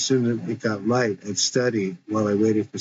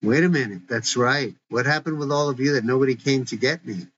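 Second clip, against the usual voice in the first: usual speaking voice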